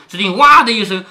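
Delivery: ordinary delivery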